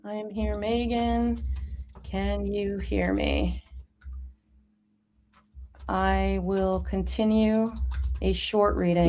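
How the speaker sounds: slow, low word-by-word typing voice; drawn-out, low dictation into the chat; chat speak. Real, not acted. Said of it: "chat speak".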